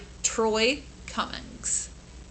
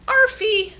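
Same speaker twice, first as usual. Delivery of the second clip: proud signature; 10 semitones higher, 6 dB louder than usual. Acted. Dog signs his letter.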